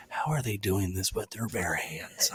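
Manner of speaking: whispered in disbelief, slow; awed whisper. Retold or imitated. Whispering to listeners about the hut video, awed. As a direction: hush it like you can't believe your eyes. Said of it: "I'm like".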